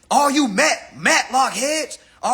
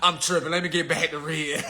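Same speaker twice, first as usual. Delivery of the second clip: laughing it off; 6 semitones lower than usual. Retold or imitated. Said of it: "Y'all be trying to laugh it off like".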